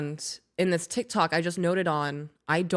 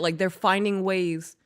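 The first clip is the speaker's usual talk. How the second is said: usual speaking voice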